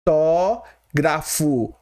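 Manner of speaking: "fotógrafo" very slowly, syllable by syllable; drawn-out teaching demo of "fotógrafo"; split into syllables. Shown as a demonstration. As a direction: break "fotógrafo" into fo, tó, grafo with long pauses. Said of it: "você vai separar assim".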